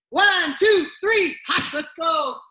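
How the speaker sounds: rally the class with a loud count. Real, not acted.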